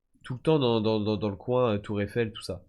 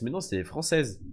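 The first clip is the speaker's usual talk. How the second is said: usual speaking voice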